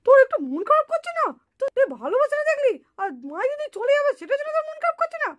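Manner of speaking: high, fast teasing prod. Acted. Boy teasing his friend about her sister leaving, high mock prod.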